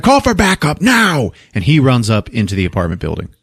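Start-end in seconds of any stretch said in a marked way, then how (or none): 0.0-1.3 high-pitched mock urgent command; hammed-up emergency order. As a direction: call it out like a panicked cop. Acts Stone ordering backup.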